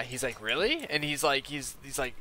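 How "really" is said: high, rising disbelief; surprised. Retold or imitated. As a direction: imitate a surprised kid, voice jumping up. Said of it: "like, surprised".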